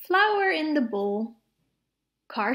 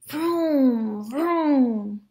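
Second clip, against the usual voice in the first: car noise; 4 dB louder than usual. Acted. Car engine sound in story.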